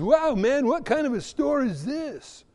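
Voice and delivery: amazed customer. Acted. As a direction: play a wowed customer walking in. Amazed customer in acted skit.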